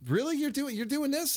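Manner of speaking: high mock disbelief; incredulous teacher. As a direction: scoff at the former student. Retold teacher's incredulous scorn.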